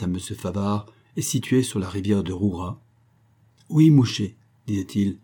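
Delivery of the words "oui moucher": put-on high, loud proud old Creole man; proud reply. A narrator's reading. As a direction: answer with puffed-up pride. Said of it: "non sans orgueil".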